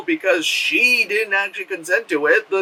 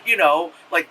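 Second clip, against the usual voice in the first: usual speaking voice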